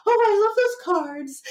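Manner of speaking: very high-pitched, mock-gushing fan voice; gushing tarot fans. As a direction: imitate people swooning over the cards, way up high. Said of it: "like how people are like".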